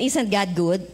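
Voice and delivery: normal talking voice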